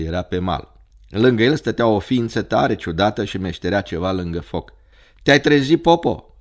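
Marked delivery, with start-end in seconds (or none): 5.2-6.4 raised, loud cracked-voiced greeting. A narrator's reading. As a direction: call out high in a cracked voice. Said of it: "cu glas dogit". (glas dogit, ridicat)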